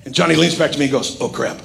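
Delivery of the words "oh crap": low, hushed confession of trouble; quiet dismay. Retold or imitated. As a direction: lean in and say it low and soft. Johnny leans back, has no passport.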